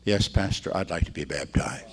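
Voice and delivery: lightly voiced sweet old dad agreeing meekly; sweetest little voice. Father gently accepting baptism, a hint of sweet little voice. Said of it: "in the sweetest little voice".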